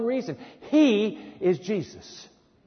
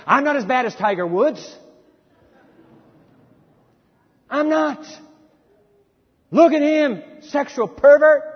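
higher, louder sneering judgment; hammed-up self-righteous sneer, louder; mock self-righteous; 6 semitones higher, 6 dB louder than usual. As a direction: play the smug pointing hypocrite. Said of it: "We may shrug off our sins".

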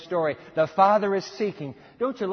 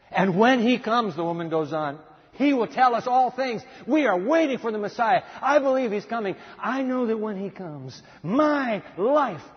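eager hope; 5 semitones higher, 4 dB louder than usual. Acted. Woman's hope, loud and high.

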